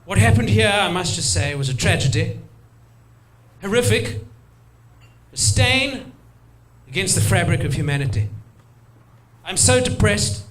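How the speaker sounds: put-on a stuffy English accent mourning at a memorial; English gentleman. English tourist giving a solemn speech in a stuffy English accent. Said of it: "the English guy".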